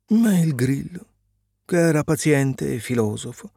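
ordinary delivery, nothing marked

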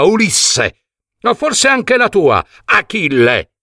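high threatening demand; bully the other heroes one by one; Agamennone shouting. A narrator's reading. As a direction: arrogant royal threat. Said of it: "gridò il re Agamennone".

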